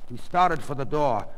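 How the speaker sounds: usual speaking voice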